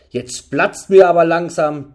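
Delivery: loud, drawn-out anger; furious. A narrator's reading. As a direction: let your temper boil up, slow and loud. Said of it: "begann Ritter Bendu wütend".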